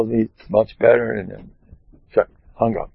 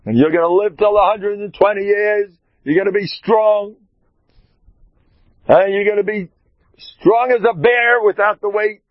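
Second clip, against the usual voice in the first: shouted blessing; 9 semitones higher than usual. Retold or imitated. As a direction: bellow good wishes like a preacher. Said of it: "I was screaming my head off".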